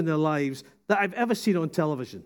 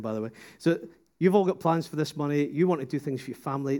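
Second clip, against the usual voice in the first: normal talking voice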